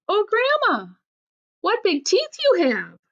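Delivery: very high storybook exclamation; crying out. A narrator's reading. Little Red to the disguised wolf, crying out high. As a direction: cry it out high in mock amazement. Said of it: "she cried".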